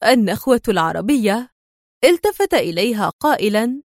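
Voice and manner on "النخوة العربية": usual speaking voice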